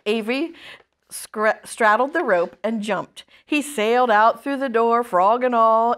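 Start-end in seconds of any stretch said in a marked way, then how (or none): none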